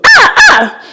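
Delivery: high, loud mock exclamation; imitating his hearty cry of pride; husband's proud exclamation. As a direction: belt it out like the proud husband would. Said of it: "Maybe I don't sound like him".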